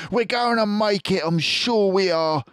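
high, hopeful fox voice; Mister Fox. A narrator's reading. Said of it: "and Mister Fox would say".

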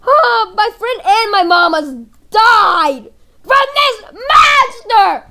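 high, loud wailing, dragged out slow; crying hysterically. Pretend. Kid reporting the monster to Officer Cool, hammed-up crying. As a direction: wail the story through sobs.

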